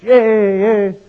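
high, loud cheering yay; mock cheer. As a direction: cheer like a delighted crowd. Workers cheering in joke.

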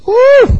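sudden very high whoop. Real, not acted. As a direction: yelp it out in disapproval. Exclamation mid-sermon.

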